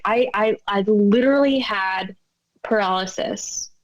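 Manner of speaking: usual speaking voice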